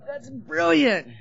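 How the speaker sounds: high, loud gushing rave, stretched slow on the second; overjoyed squeal at the cocaine table; gushing delight. Retold or imitated. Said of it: "And I'm like".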